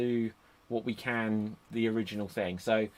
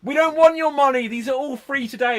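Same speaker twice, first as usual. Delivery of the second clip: high, loud mock sales pitch; Daniel Bryan; 12 semitones higher, 8 dB louder than usual. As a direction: call out like a wrestler hawking giveaways, pitched way up. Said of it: "He was just like".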